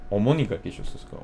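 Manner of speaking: normal talking voice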